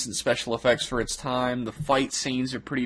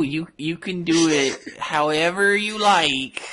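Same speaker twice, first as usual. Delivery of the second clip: mimicking the actor; 5 semitones higher than usual.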